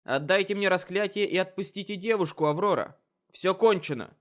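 loud, high, furious demand; commanding shout. Acted. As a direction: shout the order at her. Summer Lady confronts Aurora.

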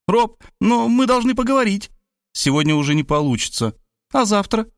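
put-on high, chirpy young girl voice; bubbly sorority girl. A narrator's reading. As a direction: bounce it like an excited teen. Varvara girl voice.

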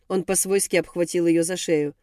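ordinary delivery, nothing marked

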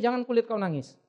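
ordinary talk

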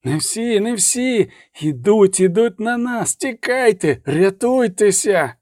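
high, urgent alarm cries; panicked cry. A narrator's reading. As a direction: cry out for everyone to flee. Acted panic of a fleeing army.

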